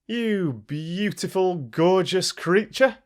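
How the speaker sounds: fawning fox praising the crow; flattering fox. Acted. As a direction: lay the flattery on thick.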